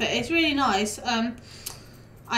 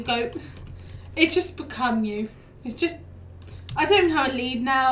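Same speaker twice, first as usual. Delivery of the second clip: usual speaking voice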